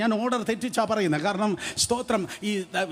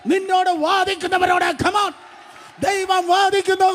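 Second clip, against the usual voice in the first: full-force preaching; 8 semitones higher than usual. Acted. Preacher proclaiming God's message to the congregation, shouting. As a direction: cry each line out to the congregation like a prophet.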